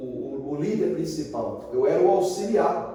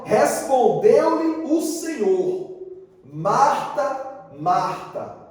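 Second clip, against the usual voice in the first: scripture reading; 4 semitones higher, much slower than usual. Acted. Reads Bible aloud slowly.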